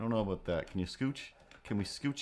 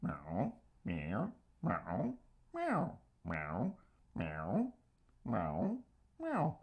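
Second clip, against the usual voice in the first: meowing at cat; 2 semitones higher, 5 dB quieter, much slower than usual. Real, not acted. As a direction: trade meows with the cat.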